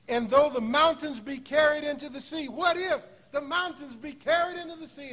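loud, high, building sermon cadence; preacher crying out the psalm's promise; full-force preaching. Acted.

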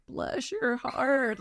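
very slow Utah drawl. Retold or imitated. Imitating the husband's slow drawl for friends. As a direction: stretch it out like the husband does. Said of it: "He's just kind of slow drawl".

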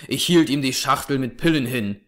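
ordinary delivery, nothing marked

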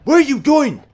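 high, loud yelling in disbelief; frantic shouted complaint at the game; exasperated. Real, not acted. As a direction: demand answers, voice leaping up. Characters keep moving, nearly glitching game.

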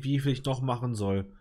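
ordinary delivery

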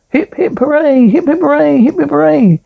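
high-pitched hip-hip-hooray; acted cheering. Crowd giving three cheers for the inspector, cheering.

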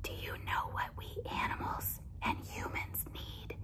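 hushed whisper to a sleeper. Acted. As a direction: whisper it so he stays asleep. Porcupines whisper to sleeping man.

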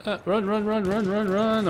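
high panicked cries of run; being chased. Real, not acted. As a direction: panic as they close in.

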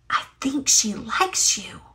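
a soft breathy confiding whisper. A narrator's reading. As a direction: whisper it close to Paddington's ear. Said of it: "whispered Judy".

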